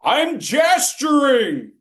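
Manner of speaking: slow, high, loud mock protest; shouted mock I'm gesturing. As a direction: wail it like an offended student. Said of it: "mock speaker's protest".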